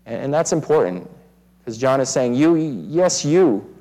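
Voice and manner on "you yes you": "You" drawn out with a higher, swooping pitch; stress on You. Retold or imitated. John singling out his readers with "you", emphatic stress. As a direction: lean hard on each "you". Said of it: "He leads with "you".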